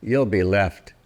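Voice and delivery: plain reading voice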